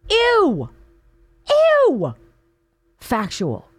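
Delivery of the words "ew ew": high shrieking ew of disgust. Real, not acted. Host recoiling from the quote, shrill disgusted ew.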